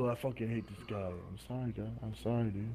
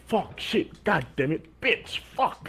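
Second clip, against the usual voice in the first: mock outburst; 7 semitones higher, slower than usual. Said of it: "I was like".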